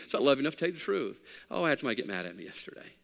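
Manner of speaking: ordinary delivery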